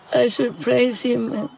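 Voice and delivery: ordinary talk, nothing marked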